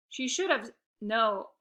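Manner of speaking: plain reading voice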